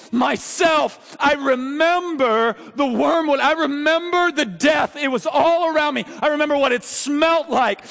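high, loud anguished lament; raw, pained preaching at full force; anguished preaching cry. Acted. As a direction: cry out the memories of war as Jeremiah. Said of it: "And he goes".

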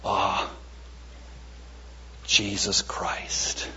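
low, hushed and very slow; reverent. Shown as a demonstration. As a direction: breathe the name out slowly as a prayer. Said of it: "I'm saying it differently today".

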